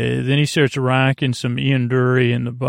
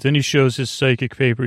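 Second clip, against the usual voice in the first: normal talking voice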